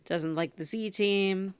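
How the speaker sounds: ordinary delivery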